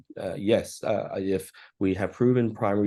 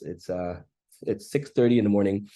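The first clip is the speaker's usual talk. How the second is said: ordinary talk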